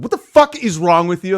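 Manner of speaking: high, loud retold outburst; mock exasperation. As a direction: snap it as the baffled wife in a story. Wife's exasperated question, retold.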